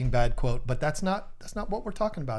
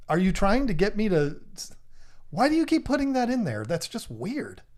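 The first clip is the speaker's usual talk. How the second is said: ordinary talk, nothing marked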